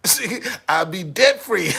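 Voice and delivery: loud, slow laughing gloat; laughing gloat about being debt free; laughing shout. Real, not acted. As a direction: crow it while laughing. Gloating punchline.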